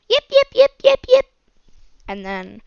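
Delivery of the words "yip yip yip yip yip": high, loud puppy yips; puppy yipping. Retold or imitated. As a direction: yip like an excited little puppy. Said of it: "Puppy goes".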